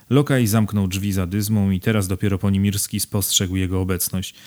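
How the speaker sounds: ordinary delivery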